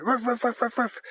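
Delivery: high mock woofs; playful retold barking; dog bark imitation. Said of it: "he was going".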